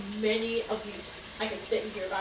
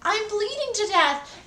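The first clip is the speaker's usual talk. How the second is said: much higher, slow, panicked mother voice; panicked mom; 12 semitones higher, 6 dB louder, slower than usual. Retold or imitated. Mom realizes her kid is bleeding, high panic. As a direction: squeal it like a frantic mom. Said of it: "then she realizes".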